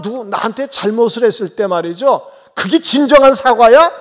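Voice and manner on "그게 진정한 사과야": louder, higher, picky disbelief; an unforgiving person doubting an apology, loud and high; demanding challenge. Pretend. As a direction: refuse to accept the apology. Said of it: "꼬치꼬치 파고들고".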